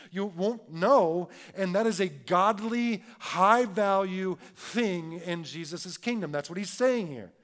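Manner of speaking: loud, high, driving preacher voice; preaching. Acted. Pastor preaching loudly to his church, full force. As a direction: preach it hard to the room.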